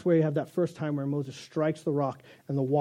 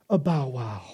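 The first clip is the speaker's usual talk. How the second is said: lower, hammed-up "bow wow"; mock dog bark; 4 semitones lower, slower than usual. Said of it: "the dog says".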